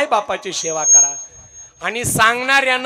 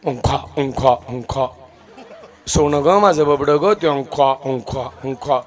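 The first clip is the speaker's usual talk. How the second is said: lightly voiced doting mother spoon-feeding; a hint of baby talk coaxing; 5 semitones lower than usual. Mother coaxing child to eat.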